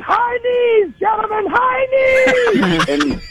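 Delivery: very high, hollered coach command; coach's shout. Retold or imitated. Head coach to linemen right before the snap, shouting. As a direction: shout it across the field like a coach. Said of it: "he'd be like".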